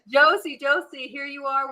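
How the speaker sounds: high, loud, delighted welcome; excited greeting. Real, not acted. Host welcomes birthday guest onto the podcast, excited greeting.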